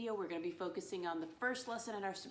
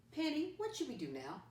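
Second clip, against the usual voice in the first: playful question; 8 semitones higher than usual. Acted. Teacher asks puppet Penny.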